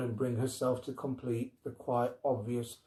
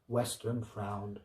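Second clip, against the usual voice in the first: ordinary delivery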